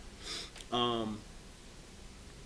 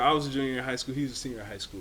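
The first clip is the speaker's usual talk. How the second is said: ordinary delivery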